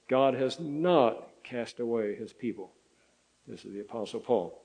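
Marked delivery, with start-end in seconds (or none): 0.1-2.7 slow, each word weighted, "not" hit hardest; stress on not. Shown as a demonstration. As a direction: slowly hammer "not" home in "God has not cast away his people". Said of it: "just to reemphasize".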